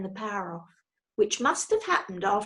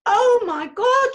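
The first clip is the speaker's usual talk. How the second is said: much higher, louder, slow horrified shriek; 14 semitones higher, 10 dB louder, slower than usual. A narrator's reading. Daughter seeing dad fall, horrified shriek. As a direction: scream it in terror. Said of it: "shrieked Judy".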